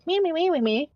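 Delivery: high-pitched mocking chant; mocking imitation. Player mimicking the rude woman who annoyed them, mocking. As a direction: mimic her in a silly high voice.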